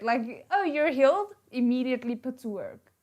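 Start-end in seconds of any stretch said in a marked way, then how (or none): 0.5-1.3 higher, louder mocking quote; lightly voiced mocking aunt. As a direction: parody her cheerfulness. Mocking the aunt's line.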